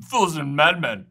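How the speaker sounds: put-on a clumsy, laughing British accent on "Fools and madmen". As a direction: try the British accent again and giggle through it. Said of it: "How's that?".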